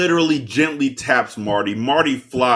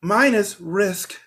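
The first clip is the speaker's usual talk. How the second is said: much higher, giggly flirting voice with laughs; 11 semitones higher than usual.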